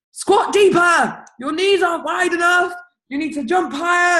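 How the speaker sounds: high, loud drill-sergeant barking; imaginary trainer. Pretend. An imagined trainer yelling at her in the gym, loud and bossy. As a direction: bark orders like a gym coach. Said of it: "I pretend like someone's going".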